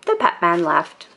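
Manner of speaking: plain reading voice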